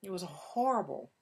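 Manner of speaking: slow, dragged-out grumble; drawn-out, half-playful complaint; mock cranky groan. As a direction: play the worn-out wife after a lousy day. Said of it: "you're cranky. You're like".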